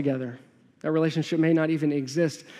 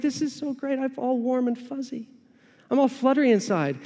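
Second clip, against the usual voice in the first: put-on swooning girlfriend; 6 semitones higher than usual. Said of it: "you're like".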